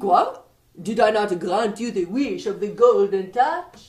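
put-on light French accent, playful stranger; a mischievous Frenchman teasing the king. French young man.

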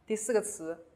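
ordinary delivery

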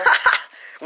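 high squeaky giggle; squeaky helium laugh. Real, not acted. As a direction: laugh with helium in your lungs.

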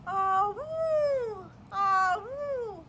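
loud, high whooping imitation of a conch horn; loud conch blow. Blowing the conch shell.

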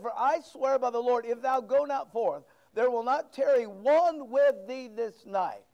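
stern warning. Acted. Joab's warning, preached loud.

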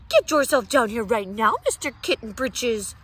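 loud, scolding order from mother; stern order. Acted. Mother yelling her son down from the bedroom, loud and stern. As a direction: bark it down the stairs at him. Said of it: "ordered Mama Junebug Jones".

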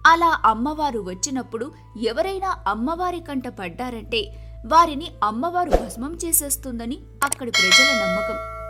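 ordinary delivery, nothing marked

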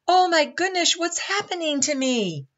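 very high, loud panicky wail; alarmed cry. A narrator's reading. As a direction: cry out as you are uprooted. Tree's roots pulled from the ground.

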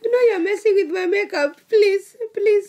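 high, whiny pleading; whiny plea. Real, not acted. Pleading while laughing hard, makeup running.

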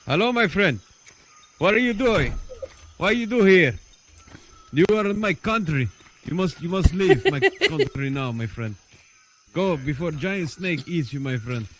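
hammed-up foreign accent, pitched high; improvised Middle Eastern-ish accent. Game pirate confronting a stranger in his country, improvised foreign accent. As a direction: put on a vague Middle Eastern accent, playfully. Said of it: "I don't even know what accent that was supposed to be".

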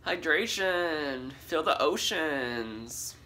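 slow dramatic. Acted.

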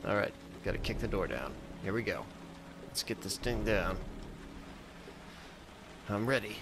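ordinary talk

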